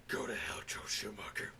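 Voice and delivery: low, voiceless mutter; whispered curse. Acted. Reviewer to Joe Schumacher, hushed hammed-up curse. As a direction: curse under your breath. Aside cursing a film director.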